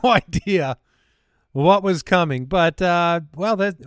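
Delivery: ordinary talk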